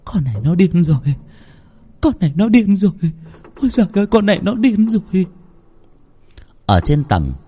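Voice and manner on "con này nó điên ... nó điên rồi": higher and higher frantic muttering, faster at the end; panicked old woman. A narrator's reading. Grandmother rushes off thinking her granddaughter is mad, panicking. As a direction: repeat it in rising panic. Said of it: "Vừa đi bà vừa nghĩ".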